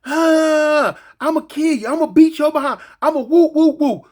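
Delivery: mock furious shouting; mock hollering threats. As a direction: yell it like a jail fight. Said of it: "a bunch of hollering".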